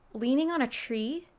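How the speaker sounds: normal talking voice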